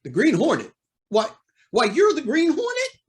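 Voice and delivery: high, loud startled question; astonished. Acted. Judge learns who his masked visitor is, astonished. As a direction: blurt it out in rising disbelief.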